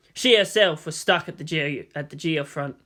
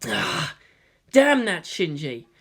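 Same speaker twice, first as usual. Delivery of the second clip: ordinary delivery, nothing marked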